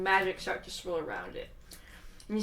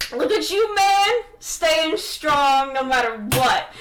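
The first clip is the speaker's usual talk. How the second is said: loud, raised, drawn-out admiration; hearty praise; 5 semitones higher, 7 dB louder, slower than usual. Acted. Merlin crushing Cain in a hug, hearty loud praise. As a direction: gush proudly over an old friend.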